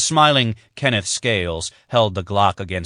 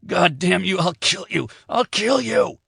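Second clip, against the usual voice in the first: much higher, rising to a frantic scream; shrieking in rage; 11 semitones higher than usual. A narrator's reading. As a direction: lose control and scream the threat. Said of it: "Jason shrieked at the visitor".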